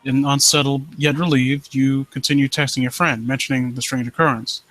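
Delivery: low, hushed creepy-story reading; story narration. Acted. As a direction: draw the listener into the dread. Reads creepy story aloud.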